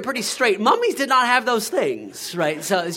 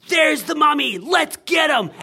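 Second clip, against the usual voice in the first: put-on higher, loud rallying shout of a kid; kid battle cry; 7 semitones higher, 3 dB louder than usual. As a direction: charge like a little pirate. Pirate kid rallies the others.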